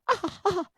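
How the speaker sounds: high-pitched pick-me noises; eager "ah, ah, ah". Acted. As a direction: squeal short ahs for attention. Audience member eager to be picked.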